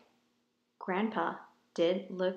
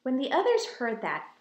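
plain reading voice

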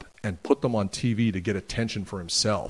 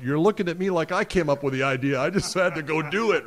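higher, indignant pushback; defensive retort; 7 semitones higher than usual. Retold or imitated. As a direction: protest that it wasn't your idea, voice up high. Retorting to Bradshaw's complaint.

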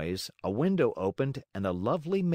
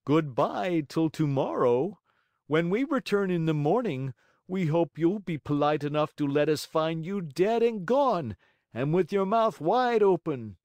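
loud, higher sneering sing-song farewell; mocking; 6 semitones higher, 4 dB louder than usual. Assassins mock the hanging marionette as they leave, loud mocking farewell. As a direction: taunt him on the way out. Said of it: "called to him mockingly".

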